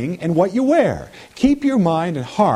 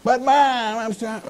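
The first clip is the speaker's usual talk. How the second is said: put-on whiny child; 8 semitones higher, slower than usual. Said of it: "you ever had your kids say".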